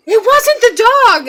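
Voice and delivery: high, loud, drawn-out imitation of a panicked mother; retold scream. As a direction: cry it out slowly in mock alarm. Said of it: "my mom screams".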